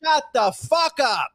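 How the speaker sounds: high, drawn-out angry dismissal. Real, not acted. Snapping back at a taunting co-host, angry. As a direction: stretch each word out in rising exasperation. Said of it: "You're angry again".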